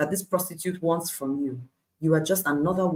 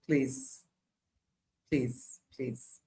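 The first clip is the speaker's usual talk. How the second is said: fading plea; 6 semitones lower, 11 dB quieter, much slower than usual. Acted. Pleading with parents, fading.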